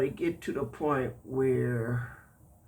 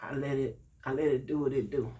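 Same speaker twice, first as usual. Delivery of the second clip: normal talking voice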